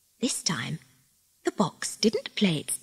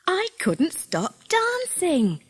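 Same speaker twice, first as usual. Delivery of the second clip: put-on child voice; 11 semitones higher, slower than usual. Davy's child voice.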